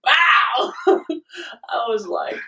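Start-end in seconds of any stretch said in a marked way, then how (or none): 0.0-0.7 sudden loud, high "bow" burst; mock blast, punched out; explosive vomit noise. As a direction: imitate a punchy blast of puke.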